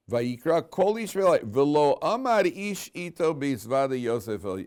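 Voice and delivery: slow, loud Hebrew scripture reading; verse recitation. Acted.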